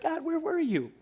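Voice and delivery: high, laughing plaintive voice; whiny complaint. Retold or imitated. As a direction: whine to God that he wasn't there. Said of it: "And then we're like".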